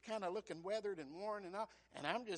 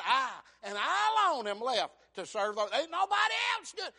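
high, loud whining protest; Elijah complaining; 7 semitones higher, 15 dB louder than usual. Acted. Preacher voicing Elijah complaining to God, loud whine. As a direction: cry out self-pity.

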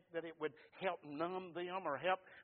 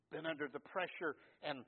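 usual speaking voice

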